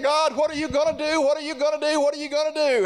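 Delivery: high-pitched preached plea to God; mock crying out. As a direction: imitate a desperate, repeated cry for help. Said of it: "he's crying, and he's saying".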